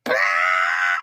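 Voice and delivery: sudden low yelp of shock; short startled shout; startled cry. Retold or imitated. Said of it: "And I was like".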